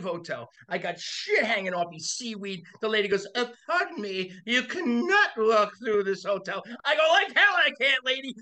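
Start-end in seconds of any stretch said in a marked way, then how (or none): 3.3-6.6 higher, slow, haughty mock lady; prim hotel lady. Hotel lady scolding him. 7.1-8.3 much higher and louder mock defiance; defiant retort. Defiant retort to hotel lady.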